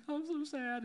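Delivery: high, hammed-up whimper of self-pity; exaggerated pretend sulk; mock sad. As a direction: play the pouting victim, way up high. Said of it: "And I'm like".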